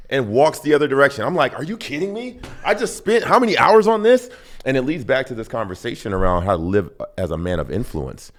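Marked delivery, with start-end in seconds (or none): 1.6-4.3 high, exasperated outburst; incredulous protest. Retold or imitated. Husband to wife walking away after his answer, incredulous. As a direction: protest in hammed-up disbelief, voice pitched way up.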